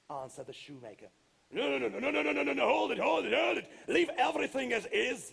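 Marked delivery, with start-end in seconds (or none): 1.5-5.3 high, loud panicked no's; yelling. Acted. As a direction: shout him down in a panic. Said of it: "yelled the giant".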